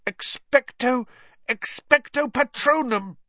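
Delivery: high, strained spell-casting; high-pitched, failing attempt then fuller cry; desperate incantation. A narrator's reading. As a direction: force the spell out alone.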